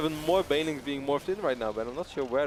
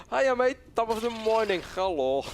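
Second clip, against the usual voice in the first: higher, louder mock Australian accent; bad Australian accent; 8 semitones higher, 4 dB louder than usual. Shown as a demonstration. Joking with a co-caster about accents, mock Australian. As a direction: ham up a failed Australian accent. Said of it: "I try to sound Australian".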